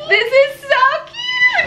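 a squealing cry of delight; excited squeal. Real, not acted. Friend overwhelmed by a tiny outfit, excited squeal.

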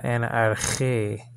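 slow, careful Greek "en arche" with a throaty ch. Shown as a demonstration. Teacher sounding out Greek for the class, slow and careful. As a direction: spell out "en arche" slowly, Greek style. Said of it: "right, in Greek".

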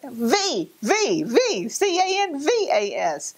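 high, slow, letter by letter; spelling it out slowly. Retold or imitated. To the phone operator, spelling slowly and high.

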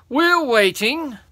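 loud, high, drawn-out demand; impatient giant. Acted. Second giant pressing the boy, impatient. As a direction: stretch it out as a waiting giant.

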